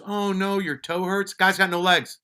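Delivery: high, teasing fake sympathy; mocking. Amputee teasing the speaker over his sore toe, high mocking. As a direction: needle him with mock pity. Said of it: "he was making fun of me".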